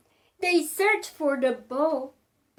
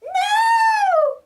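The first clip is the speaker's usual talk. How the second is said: much higher, louder scream; cried no; 5 dB louder than usual. Acted. Witch fleeing the dragon, high scream. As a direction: scream it in terror. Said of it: "cried the witch".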